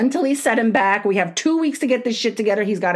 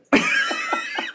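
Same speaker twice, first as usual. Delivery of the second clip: high squeal of laughter. Real, not acted. Laughing at own story.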